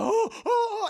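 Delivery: high, loud oh oh; gasping in horror; frightened yelp. Retold or imitated. Priest sees something eat the rat.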